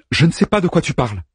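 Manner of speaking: high, quick flustered lie; flustered denial. Acted. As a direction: blurt the denial, caught out. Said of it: "Elle mentait".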